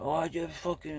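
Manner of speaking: high mock snarl, imitating them; mocking rude snap. Mimicking a stranger who snaps at him, high mock snarl. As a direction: sneer it back like the ungrateful stranger.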